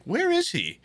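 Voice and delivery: high concerned calling; fretting where he is; mock worried family voice. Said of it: "everyone downstairs is like".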